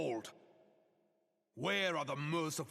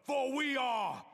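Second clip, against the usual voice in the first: rallying shout; 7 semitones higher, slower than usual. Acted. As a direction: hurl the last words at the crowd. Climax of rallying speech.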